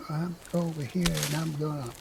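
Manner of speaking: speaking softly, barely audible; quiet mumbling. Retold or imitated. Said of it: "you sound like this".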